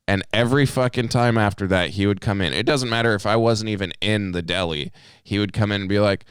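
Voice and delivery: usual speaking voice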